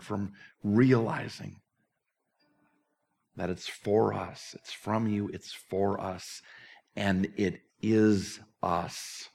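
reverent, slowed closing prayer. Acted. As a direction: pray it gently to close the service.

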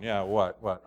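low, soft grumbling reply; irritable, half-listening response; grumpy husband. Acted. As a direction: grunt back like a grouchy husband. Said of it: "You see me at my best".

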